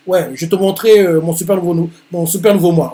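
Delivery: a hint of a cocky shounen hero. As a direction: announce the new self proudly. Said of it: "lui fait".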